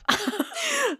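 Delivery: very high squeal of laughter; embarrassed laugh. Real, not acted. Teller finishing an embarrassing story, squealed laugh.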